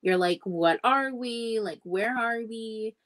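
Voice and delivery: ordinary delivery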